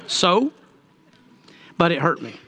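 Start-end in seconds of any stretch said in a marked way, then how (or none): 0.0-0.5 imitated scoffing little laugh, then a questioning "So?"; mock dismissive chuckle. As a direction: laugh it off like an unimpressed girlfriend. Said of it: "She kinda laughed".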